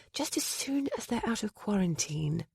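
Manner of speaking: muttered softly aside; under her breath. Acted. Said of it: "Under her breath she added".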